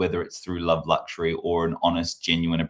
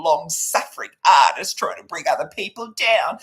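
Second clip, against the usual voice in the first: high, loud, sneering falsetto critic; mock critic; 13 semitones higher, 3 dB louder than usual. Mocking a commenter lecturing Kyle, high falsetto. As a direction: parody a smug viewer scolding him. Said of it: "that monologue".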